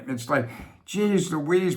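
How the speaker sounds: usual speaking voice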